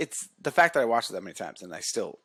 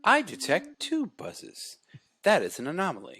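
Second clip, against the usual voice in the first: toy robot voice; 7 semitones higher than usual. Retold or imitated. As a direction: scan like a little toy robot. Voicing robot cat.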